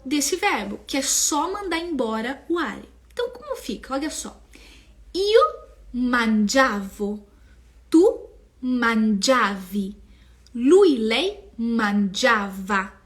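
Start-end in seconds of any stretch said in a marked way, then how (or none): 5.1-12.9 slow, loud teacher drill; carefully paced classroom recitation; slow model conjugation. Shown as a demonstration. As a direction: spell out each form slowly. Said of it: "E aqui é muito importante".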